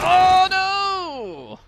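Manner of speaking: high, loud hammed-up alarm; mock panicked cry. Player reacts to the banshee attack in the game, mock panicked cry. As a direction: play at a shrieking panic.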